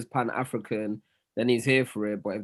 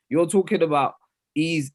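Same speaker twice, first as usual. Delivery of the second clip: ordinary delivery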